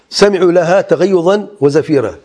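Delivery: measured Quranic chant; Quran recitation. Acted. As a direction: intone the verse slowly and high. Recites verse slowly.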